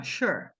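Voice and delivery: "sure" as transcribed for Portuguese ears; demonstrated English pronunciation. Shown as a demonstration. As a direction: model the English word "sure" for learners. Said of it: "a gente escreveria assim".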